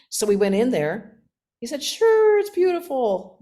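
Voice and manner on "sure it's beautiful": high, loud burst of reassurance; eager coaxing. Retold or imitated. Companion coaxing a doubtful teenager into the temple, high and eager. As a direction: imitate an upbeat friend urging someone in.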